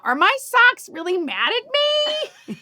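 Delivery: high, drawn-out comic wail; mock distress. Joking about tidying with her friend, mock distress. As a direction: push it up high and slow in mock alarm. Mock worry in retold joke.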